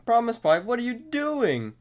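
high-pitched mock yell; hammed-up cry of protest; imitated scream. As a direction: call out in high acted alarm. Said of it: "screams out to Promise Pie".

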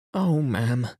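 pleading upset cry; distressed maid. A narrator's reading. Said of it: "She was crying and wringing her hands".

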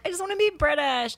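lightly hammed-up eager imitation; wannabe Brit. Mimicking people who just want to be British, mock eager. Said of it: "those people that's like".

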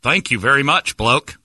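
put-on Australian accent; fake Australian accent. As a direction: send them off as a faux Australian. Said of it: "talk to the waiter as an Australian".

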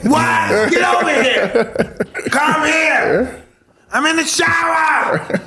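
very high, drawn-out yelled reply; exaggerated slow high holler; screaming through the house. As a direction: bellow from the shower in comic exasperation. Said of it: "You gotta scream through the house".

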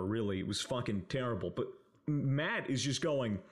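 usual speaking voice

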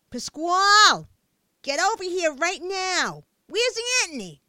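loud, high yell down the block; yelling mother. A narrator's reading. Mother yelling for her son down the block, loud yell. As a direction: call him in, yelling. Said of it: "was yelling from halfway down the block".

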